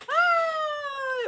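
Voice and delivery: high delighted squeal; kids squealing. Acted. Children squealing as Pegasus swoops, high delighted squeal. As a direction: shriek with joy mid-flight.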